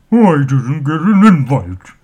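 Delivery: loud booming troll voice. A narrator's reading. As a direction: boom it out like a troll. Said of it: "in a booming voice".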